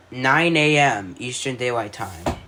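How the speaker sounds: plain reading voice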